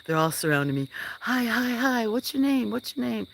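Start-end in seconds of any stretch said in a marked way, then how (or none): 1.2-3.3 put-on eager kids. Kids surrounding him on the hill, imitated in a high child voice. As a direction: pile on the questions like excited kids. Kids swarming and asking questions.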